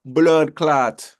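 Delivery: loud, high Jamaican exclamation; put-on Jamaican accent, punched out. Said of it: "That's my Jamaican accent".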